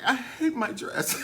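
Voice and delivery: high, laughing whine. Retold or imitated. Jinx complaining about her dress, laughing high. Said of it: "Jinx is like".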